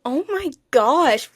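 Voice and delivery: ordinary delivery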